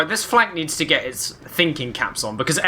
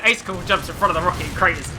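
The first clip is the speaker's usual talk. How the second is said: ordinary delivery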